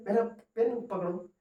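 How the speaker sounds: usual speaking voice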